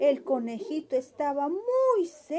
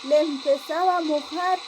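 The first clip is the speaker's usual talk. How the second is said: plain reading voice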